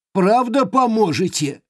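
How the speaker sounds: high, happy little creature; joyful ringing voice. Acted. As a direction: ring with sudden joy. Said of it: "звенела радость". (радостный звонкий голосок)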